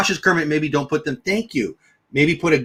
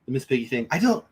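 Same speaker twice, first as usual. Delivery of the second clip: ordinary talk, nothing marked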